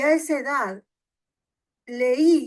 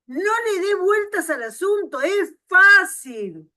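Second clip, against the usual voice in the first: fervent exhortation; 8 semitones higher, 10 dB louder than usual. Acted. Preacher exhorting congregation.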